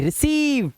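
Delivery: रिसीव with long, high "seev"; corrected रिसीव with drawn-out vowel; long vowel sound. Shown as a demonstration. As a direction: hold the सी of रिसीव as "ee". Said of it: "रिसीव नहीं".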